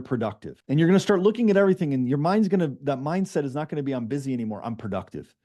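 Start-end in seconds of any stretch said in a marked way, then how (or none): none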